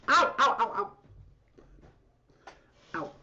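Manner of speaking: pained laughing ow; laughing ouch. Real, not acted. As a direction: yelp through a laugh. Cat claws into knee.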